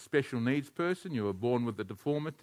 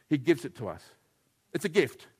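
ordinary talk

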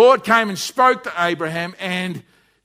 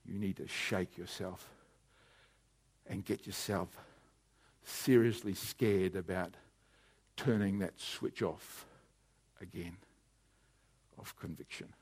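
much softer, slowed, fading nearly to a whisper; hushed preaching; 14 semitones lower, 20 dB quieter than usual. Acted. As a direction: lean in and hush the room to conviction. Intimate close of sermon.

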